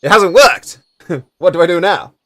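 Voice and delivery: high, loud comic dismay; acted panic after a failed strike; dismayed attacker. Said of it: "and it's just like".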